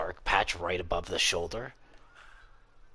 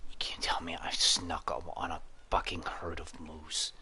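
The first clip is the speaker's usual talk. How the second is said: softer whisper; 2 semitones lower, 5 dB quieter than usual. Real, not acted. Hunter stalking game, whispering. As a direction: hush so the animal won't hear.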